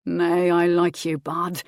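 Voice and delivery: flirting husband. A narrator's reading. Husband flirts with wife, slow male voice. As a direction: linger over it, pleased.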